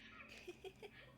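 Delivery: high, soft, distant cackle; a faint creepy giggle; eerie laugh. Acted. Sinister laugh after scene.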